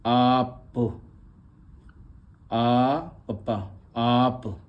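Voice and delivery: आप split into आ and प, slowly; sounding out. Shown as a demonstration. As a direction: build आप sound by sound for the class. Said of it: "teacher sounds out आप". (आप को टुकड़ों में बोलना)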